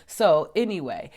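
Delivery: ordinary talk, nothing marked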